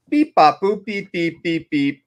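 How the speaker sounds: mock register beeping; register beeps. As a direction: beep like a cash register.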